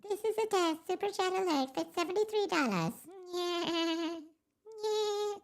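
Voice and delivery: very high mock alert voice; squeaky mimicry. Streamer mimics his own test chat alert, squeaky mimicry.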